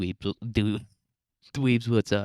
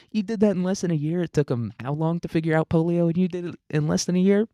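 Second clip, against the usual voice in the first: mocking disbelief; 9 semitones higher than usual. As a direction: sneer it back at them in disbelief. Mocking fast vaccine fans.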